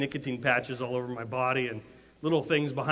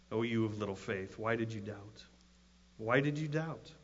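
soft, low, calm reproach; calm rebuke; 3 semitones lower, 7 dB quieter than usual. Acted. Jesus to Peter after grabbing him, calmly. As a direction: say it gently, unhurried and quiet. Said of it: "and then calmly said".

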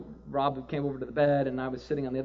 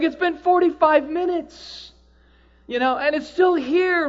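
amazed wonder; 13 semitones higher, 9 dB louder, slower than usual. Retold or imitated. Her amazed report.

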